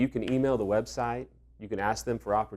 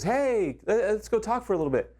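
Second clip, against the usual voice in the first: lightly voiced boss, higher, casually inviting; Rick's casual call; 10 semitones higher than usual. Mentor Rick calls him in from staff meeting, light casual voice. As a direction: boss calling him in for a chat, a hint of breezy. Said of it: "he goes".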